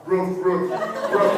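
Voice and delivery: loud, spaced woofs; imitated barking dog. Teasing mothers who repeat themselves, loud dog barking. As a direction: bark it out at the audience. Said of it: "It's like that dog".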